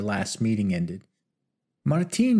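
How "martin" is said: higher, louder joyful call; light up at seeing her lover; delighted exclamation. Acted. Rosita spotting her lover Martin, joyful higher call. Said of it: "she exclaimed".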